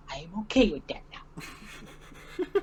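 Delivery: slow laughing character line; character quote. Retold or imitated. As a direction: quote it slowly, amused. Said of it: "to quote a different character".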